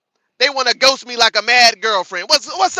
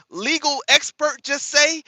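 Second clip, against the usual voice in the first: ordinary talk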